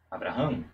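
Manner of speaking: "Abraham" said in one quick flow; run-together Abraham. Shown as a demonstration. Teacher demos the rushed name, quick flow. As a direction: glide through "Abraham" without stopping. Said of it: "é uma pronúncia corrida".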